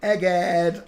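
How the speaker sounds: usual speaking voice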